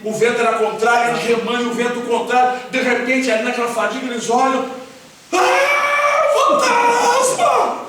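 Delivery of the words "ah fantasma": high, drawn-out shriek of fright; loud, high-pitched acted panic cry; terrified screaming. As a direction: scream it as if seeing a ghost. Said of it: "começam a gritar".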